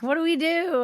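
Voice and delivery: "What do we do?" pitched high in mock panic; a playful alarmed question. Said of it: "I'll be like".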